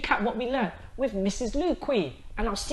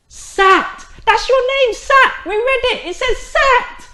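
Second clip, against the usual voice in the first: excited; 13 semitones higher, 8 dB louder, slower than usual. Real, not acted. Excitedly reading first word.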